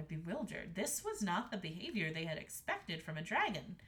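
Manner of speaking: usual speaking voice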